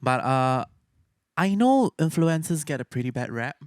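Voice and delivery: ordinary delivery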